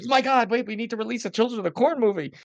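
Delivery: high-pitched, frantic imitation; mock panic. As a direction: act out a panicked scramble, voice jumping way up. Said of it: "so it popped up and he panicked".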